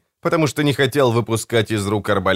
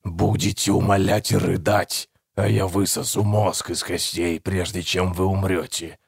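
talking tree, menacing; 2 semitones lower, 4 dB quieter than usual. A narrator's reading. Hidden creature's long gruesome threat.

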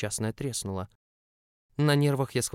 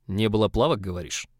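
suspicious; 5 dB louder than usual. Acted. Август suspicious, repeats words.

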